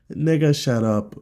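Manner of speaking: usual speaking voice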